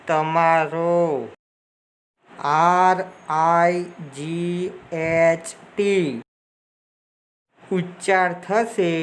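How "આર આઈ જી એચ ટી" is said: slow, letter-by-letter spelling. Shown as a demonstration. Teacher spells an English word for learners, slow spelling. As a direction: spell it slowly, each letter held.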